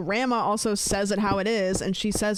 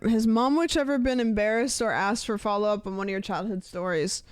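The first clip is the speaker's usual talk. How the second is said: ordinary talk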